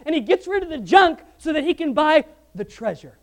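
a loud, high preaching build; fiery preaching. Acted. Preacher urging the congregation toward Christ, fiery build. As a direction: drive the point home to the congregation.

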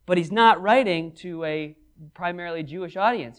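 ordinary delivery

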